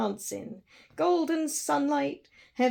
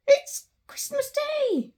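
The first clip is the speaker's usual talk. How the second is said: much higher, slow, wondering; amazed realisation; 4 dB louder, slower than usual. Acted. Scrooge realising it is Christmas Day, amazed delight. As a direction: let the wonder rise in it.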